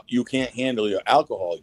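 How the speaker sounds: normal talking voice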